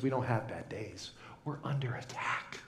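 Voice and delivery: whisper. Pretend.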